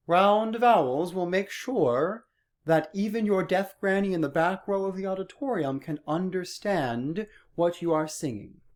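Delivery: sing-speak it with rounded vowels to reach the back row; Singlish voice. Shown as a demonstration. Choir teacher demonstrating Singlish round vowels. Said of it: "see how my speaking voice is different than my Singlish voice".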